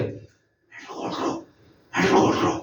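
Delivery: hushed, breathy whispered command; soft whispered instruction to a child; whispering tone. Shown as a demonstration. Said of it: "मैं इसको whispering tone के साथ भी गाऊंगा".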